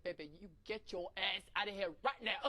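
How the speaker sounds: mock stern order, punched words; retold shouting. Coach ordering her out of the locker room, mock shouting. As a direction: imitate a father figure ordering her out. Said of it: "like, shouting at me".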